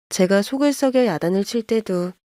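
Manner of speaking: usual speaking voice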